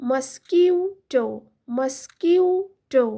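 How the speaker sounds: মসকিউটো said very slowly; slow model word. Shown as a demonstration. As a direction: draw out মসকিউটো syllable by syllable. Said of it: "মসকিউটো মানে হলো". (মসকিউটো ধীরে ধীরে বলা)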